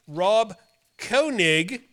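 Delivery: ordinary talk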